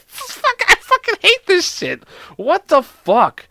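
high-pitched swearing through laughter; laughing protest. Real, not acted. Hating the joke just read aloud, laughing. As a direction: protest while cracking up. Cracks up, hates the jokes.

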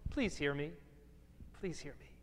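very soft, low appeal; low, earnest near-murmur; hushed plea. Acted. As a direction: drop right down and plead quietly to be heard. Pleading aside to the congregation.

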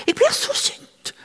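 high, airy and slow mock-angry complaint; young nurse venting her anger, high and breathy; imitating angry young woman. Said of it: "sa hun".

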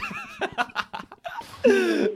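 high-pitched burst of laughter; real laugh. Real, not acted. Host amused by own British bit, high laughter. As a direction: crack up in a high giggle.

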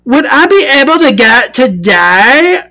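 high, put-on voice for the buyer's text. Reading the buyer's first message, high put-on voice.

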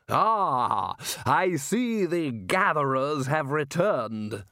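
booming, slow, happy greeting; hearty roar. A narrator's reading. Ridcully greets the returning gatherers, booming and slow. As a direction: bellow it cheerfully across the beach. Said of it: "roared Ridcully happily".

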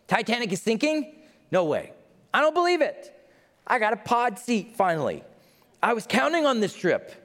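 high, disbelieving outburst; mock outrage at the news; a touch of hammed-up disbelief; shocked passenger. Passenger hearing ship sinks.

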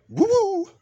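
very high, loud "woo-woo"; closing whoop. Real, not acted. Host ending his show, high loud whoop.

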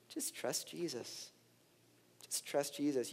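softly voiced simple lesson; Sunday school niceness. Pastor echoing a too-easy Sunday school lesson, soft niceness. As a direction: say it gently, like a pat Sunday school answer. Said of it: "a really nice Sunday school lesson".

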